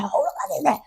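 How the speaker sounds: high, insistent mock outrage; retold angry demand. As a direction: demand it into the phone, pitched high. Retold demand over the phone.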